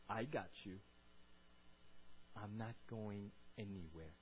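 low, soft, slow promise; quietly steady someone who is afraid; deep hushed assurance; tender reassurance. Acted. Voices God staying with you.

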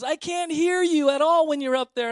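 loud, very high imitated holler; cheerfully booming reply from someone hard of hearing; Thelma. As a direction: mimic a deaf friend shouting back. Imitating Thelma shouting she can't hear.

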